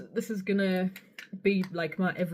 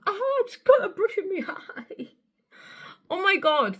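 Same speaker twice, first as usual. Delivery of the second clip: very high squeal breaking into laughter; laughing squeal; 11 semitones higher, 4 dB louder than usual. Real, not acted. Vlogger poking her eye with brush, laughing squeal. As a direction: squeal it, then laugh through the words.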